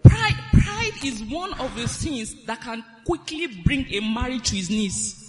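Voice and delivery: loud preaching, hammering the word pride; preaching at full force. Acted. Wife warns the congregation about pride, loud preaching. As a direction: drive the warning home to the hall.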